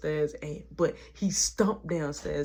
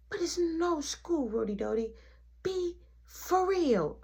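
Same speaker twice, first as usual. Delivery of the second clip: put-on high, drawn-out childish protest; imitating a kid pleading slowly; stretched-out child whine; whiny little boy; 10 semitones higher, slower than usual. Little boy argues back.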